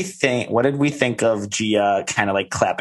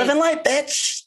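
very high put-on squeal; high, hammed-up mimicry with laughter; catchphrase impression. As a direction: shriek it playfully as a catchphrase. Quotes a catchphrase, then laughter.

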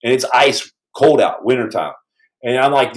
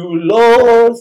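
mock praise shout; 8 semitones higher, 6 dB louder than usual. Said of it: "I'm like".

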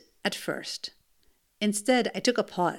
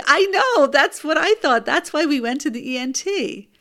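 much higher and louder, fed-up agreement; exasperated agreement; 6 semitones higher, 6 dB louder than usual. Retold or imitated. Mother agrees with pediatrician, loud and exasperated. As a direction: burst out in vindicated exasperation.

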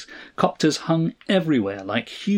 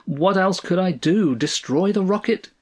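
usual speaking voice

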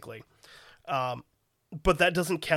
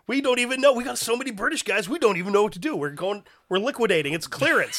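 higher, louder hammed-up clearance-sale pitch; mock salesman pitch; 6 semitones higher, 3 dB louder than usual. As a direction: sell it like a pushy salesman dumping stock. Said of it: "It's like".

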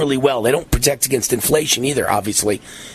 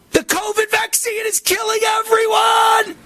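frantic shout. Retold or imitated. Host voicing the doctor's real meaning as a frantic high shout. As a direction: scream it like the ugly truth. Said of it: "what he really means to say".

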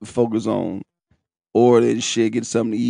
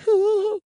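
excited whoop. Real, not acted. Celebrating food, whoop.

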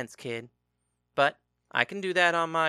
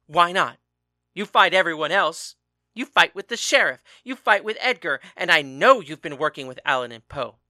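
loud, raised furious tirade; angry shouting; 8 dB louder than usual. A narrator's reading. Father shouting at husband about training their daughter, angry. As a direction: hammer each accusation home.

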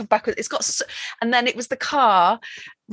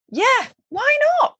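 high sing-song; slower than usual. Real, not acted. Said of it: "Why not drive".

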